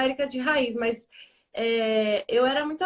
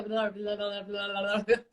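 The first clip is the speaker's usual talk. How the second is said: soft, lightly voiced toddler babbling; imitated baby babble; 4 semitones lower, 6 dB quieter, slower than usual. Mother imitating her child chattering to dolls, soft mock babble. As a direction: run the syllables together quietly, like mock baby talk. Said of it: "ela não fala ainda".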